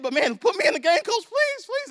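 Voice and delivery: pleading, rising high on please like an eager little benchwarmer; begging kid. Pretend. Kid at end of bench begs coach, high pleading. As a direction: beg the coach to play you.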